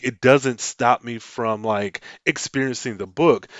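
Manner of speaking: ordinary talk, nothing marked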